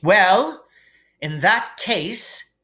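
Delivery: high, slow, measured; stern teacher. A narrator's reading. McGonagall weighs Hermione's story, stern. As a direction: weigh it up before judging.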